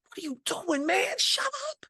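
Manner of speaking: high, hushed-down mock protest; alarmed roommates. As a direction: scold him in a high, strained voice. Roommates retold reacting to his yell.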